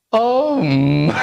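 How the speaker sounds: om that cracks on the first try; a timid, cracking om. Retold or imitated. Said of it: "Voice cracks".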